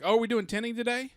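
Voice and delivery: high, loud, wide-eyed mock surprise; clueless salesman. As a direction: play the oblivious salesman who forgot everything. Salesman forgot the tint again.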